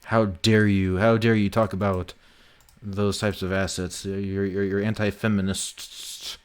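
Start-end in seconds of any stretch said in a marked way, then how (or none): none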